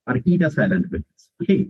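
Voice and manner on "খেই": ordinary talk, nothing marked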